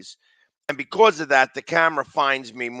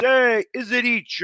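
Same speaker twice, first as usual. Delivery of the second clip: higher, slow mimicked fan cry; imitated fan; 8 semitones higher than usual. Host mimicking a garbled word from the promo, higher and drawn out. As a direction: draw it out, mimicking the fan's call. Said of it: "And he goes".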